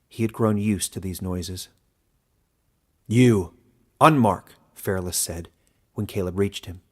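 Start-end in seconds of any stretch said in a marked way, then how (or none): 3.1-4.5 much louder contemptuous call; elder's summons. A narrator's reading. Elder summoning the outcast, loud and scornful. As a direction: call him out sharply.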